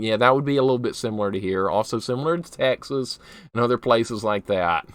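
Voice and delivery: usual speaking voice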